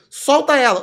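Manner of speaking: sharp yelled command, high and loud; shouted order. Retold or imitated. Cop yelling at the kid to let her go, loud command.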